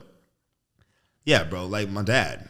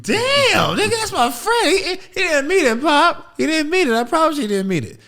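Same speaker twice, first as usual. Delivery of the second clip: very high, loud squeaky pleading; high whiny kid begging loudly; pleading little kid; 9 dB louder than usual. Retold or imitated. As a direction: beg dad to spare your friend.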